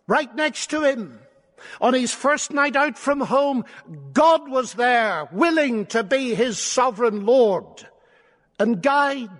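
high, emphatic preacher's voice; impassioned proclamation. Real, not acted. Preacher declaring God was beside Jacob, impassioned proclamation. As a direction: proclaim it with rising conviction.

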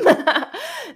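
loud, high laugh bursting out; high burst of laughter. Real, not acted. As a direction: let a big laugh escape. Laughing at her own rebelliousness.

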